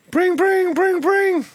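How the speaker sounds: high, loud trilled telephone ring; sing-song pretend ringtone; imitated phone ring. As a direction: mimic an old phone ringing out loud. Imitates a phone ringing before mock call.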